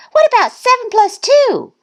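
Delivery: high, triumphant shout. A narrator's reading. Thistle answering her own quiz, high shout. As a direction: shout it like a show-off duckling. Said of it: "Thistle shouted".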